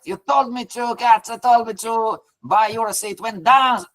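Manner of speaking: high-pitched pretend accusation; lightly acted, high and whiny; mock complaint. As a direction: play the upset trader blaming the boss. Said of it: "you can yell at me".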